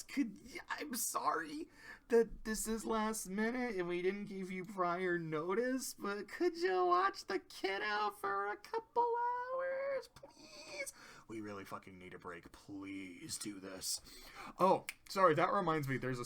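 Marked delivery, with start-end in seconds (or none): 0.0-10.9 much higher, whispered start, rising into a desperate squeak; pleading parents. Retold or imitated. Parents begging Twilight to babysit, high pleading squeak. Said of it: "Cadence and Shining Armor show up and are like". 11.3-14.0 weary desperation. Retold or imitated. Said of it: "Cadence and Shining Armor show up and are like".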